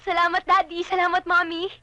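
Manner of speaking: high, loud, grateful exclamation; elated and loud; joyful thanks. Acted. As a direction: thank them with bubbling delight. Thanks parents for granting wish.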